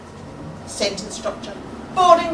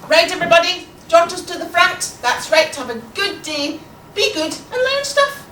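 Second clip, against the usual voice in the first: raised classroom voice, rising at the end; bright-and-busy teacher addressing pupils; brisk teacher; 4 semitones higher, 4 dB louder than usual. Acted. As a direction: call the class to order.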